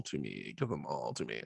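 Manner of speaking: low, soft greedy growl. Retold or imitated. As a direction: growl it like a hoarder. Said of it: "I was like".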